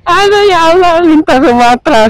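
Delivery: mock crying. Real, not acted. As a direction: wail it like fake crying. Stuck crawling in traffic.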